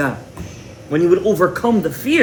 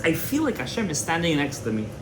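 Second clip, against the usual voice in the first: much softer, reverent quote; hushed awe; 9 dB quieter than usual. Retold or imitated. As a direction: speak it as if God stands beside you. Rabbi's awed quote of presence.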